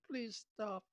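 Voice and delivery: high little begging voice; mock squeaky plea. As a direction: squeak it like a hurt tongue. Tongue's plea.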